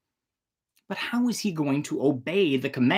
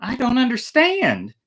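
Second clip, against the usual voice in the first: high, bright puzzled reply; puzzled daughter, pitched up; surprised princess; 9 semitones higher, 4 dB louder than usual. A narrator's reading. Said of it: "she answered in surprise".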